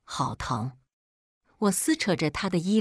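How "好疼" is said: small soft whimper, playing the victim; soft and pitiful, asking for sympathy. A narrator's reading. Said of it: "可怜兮兮地喊着". (可怜兮兮地小声喊疼)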